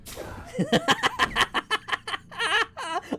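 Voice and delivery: high wheezing laughter; shrieking laugh. Real, not acted. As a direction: crack up, shrieking. Bursts out laughing.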